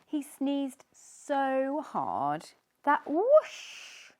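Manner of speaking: slow, loud build to whoosh; dramatic whoosh. A narrator's reading. Narrator telling of the huge sneeze, slow and loud. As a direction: stretch it out big.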